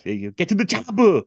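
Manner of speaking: mock Austrian Arnold bark; Arnold impression. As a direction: shout it like Schwarzenegger in the jungle. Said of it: "Arnold sayings".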